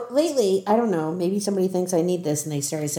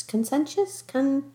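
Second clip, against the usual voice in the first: ordinary talk, nothing marked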